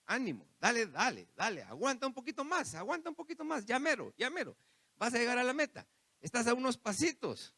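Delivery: encouraging Lord. Acted. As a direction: urge a weary believer not to give up. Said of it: "ahí está el Señor con usted diciéndole".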